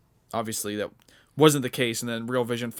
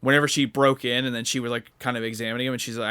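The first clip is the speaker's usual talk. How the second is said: ordinary talk, nothing marked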